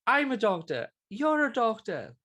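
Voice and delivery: high, slow mock TV doctor announcement; TV doctor intro. Mimicking the TV doctors introducing themselves, hammed up. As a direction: ham up a cheesy TV show opening. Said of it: "these doctor goes".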